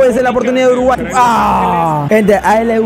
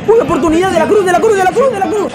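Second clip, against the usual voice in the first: high, rising excited call of a chance; excited play call; 9 semitones higher than usual. Real, not acted. Live chance in the match. (narración emocionada y aguda de la jugada)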